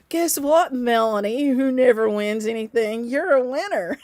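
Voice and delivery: high, loud winner reveal; excited announcement. Real, not acted. Host telling Melanie who never wins she's won, excited announcement. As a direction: build suspense, then burst out with the win.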